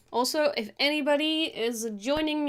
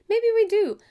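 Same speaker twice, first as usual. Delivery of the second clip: sudden higher, louder twist; playful reversal; 11 semitones higher, 7 dB louder than usual. Real, not acted. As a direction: flip the idea playfully.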